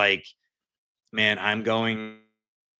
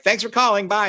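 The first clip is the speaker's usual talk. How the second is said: much higher, bright mock bot sign-off; chirpy hang-up; 11 semitones higher, 6 dB louder than usual. Guest imitating a phone system that hangs up, chirpy. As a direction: mimic a cheery robot hanging up on you.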